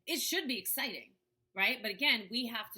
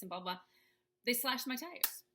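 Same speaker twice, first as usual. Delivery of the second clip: ordinary talk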